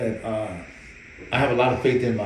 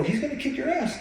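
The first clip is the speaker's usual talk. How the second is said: mock warning. Trainer warning.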